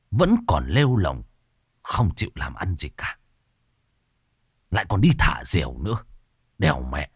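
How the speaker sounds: low, grumbling scold; exasperated old teacher. Acted. Teacher Khoan scolding the careless youths, low and exasperated. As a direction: grumble low through clenched teeth. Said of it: "nhăn mặt nghiến răng".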